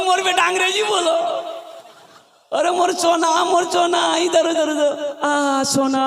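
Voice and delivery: high, gushing proud mother's voice; delighted mother. Retold or imitated. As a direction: coo over your son like a thrilled mother. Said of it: "माँ खुश हो गई". (बेटे पर निहाल माँ की ऊँची, लाड़ भरी आवाज़)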